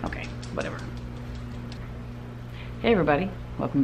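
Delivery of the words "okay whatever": whispered, resigned. Real, not acted. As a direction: breathe it out, giving up. Resigned aside, no voice.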